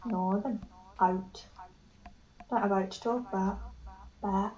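softly trying out northern English vowels; northern English accent. Shown as a demonstration. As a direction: repeat it quietly, really northern. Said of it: "I just went really northern".

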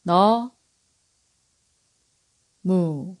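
너 and 무 split apart with a long pause between; syllable by syllable. Shown as a demonstration. Teacher splitting 너무 into syllables for learners. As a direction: break 너무 into its two syllables, 너 then 무. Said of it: "break it into syllables so that you can repeat".

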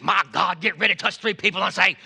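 mock a thundering call to the congregation; T D Jakes impression. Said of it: "And then you got T D Jakes".

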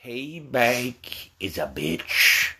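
very slow, soft, low threat; menacing drawl. Acted. Parent vowing revenge on kid, slow menacing drawl. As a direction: stretch each word out, low and ominous.

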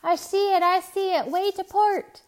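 high, loud cry of sighting; yelling. A narrator's reading. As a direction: yell out pointing to port. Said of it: "Timothy yelled".

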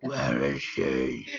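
deep, slow growl, held back; Batman. Retold or imitated. Hamming the quote for co-host, very deep Batman growl. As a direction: rumble it very low and slowly. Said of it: "If you could see your face when he did that".

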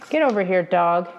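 loud, rough call to the dog; yelling. Acted. Indian Agent to his dog, a loud yell. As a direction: bark the order across the field. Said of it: "the Indian Agent yelled".

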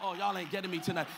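ordinary delivery

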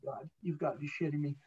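soft, low disbelieving groan; a goalie groaning under his breath at his teammate's call. Retold or imitated. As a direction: mutter it to yourself in disbelief. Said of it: "I'm going".

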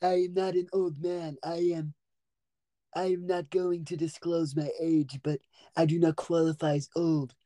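measured, carefully pronounced mock-dignified voice; put-on formal character. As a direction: answer in a stiffly formal put-on voice. Stays in put-on voice.

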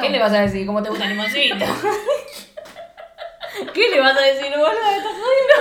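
real laughing teasing; laughing tease. Real, not acted. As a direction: egg her on while cracking up.

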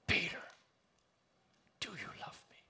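hushed, breath-only plea after the name; whispered question. Acted. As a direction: ask it in a searching whisper. Jesus asks Peter a third time.

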